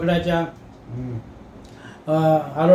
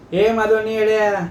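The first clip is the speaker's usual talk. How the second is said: a raised, angry jab at the brother-in-law; flaring up; 4 semitones higher than usual. Acted. Shiva hurling an insult at Daji, flaring up. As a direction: snap back in sudden temper. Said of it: "Shiva सुद्धा आता भडकला".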